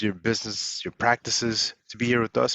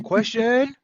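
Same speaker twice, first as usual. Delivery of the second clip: high, loud hammed-up question; playful mock shout of question; mock all-caps shout; 12 semitones higher, 4 dB louder than usual. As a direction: yell the word like all caps. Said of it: "we don't want you screaming at us like".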